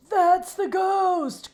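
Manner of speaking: high, loud, slow frightened cry; terrified horse, loud and high; scared cry. A narrator's reading. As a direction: shout it in fright. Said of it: "cried Coco".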